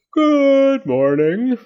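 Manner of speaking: high, very slow drawn-out greeting; slow sleepy moth. A narrator's reading. The big moth wakes and greets Fletcher and Zenobia, slow and drawn out. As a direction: stretch it like a giant moth waking.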